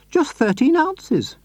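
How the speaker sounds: put-on high, sweet old lady voice; Mrs Silver voice. A narrator's reading. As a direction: lift into a gentle, doting lady.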